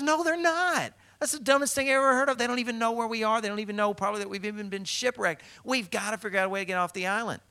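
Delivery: high, fast, agitated protest; frantic disbelief. Acted. Castaway arguing that no rescue is coming, frantic.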